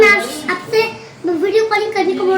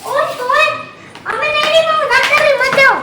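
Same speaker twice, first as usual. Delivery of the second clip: urgent pleading; 7 semitones higher than usual. Real, not acted. Pleading with aunt not to leave.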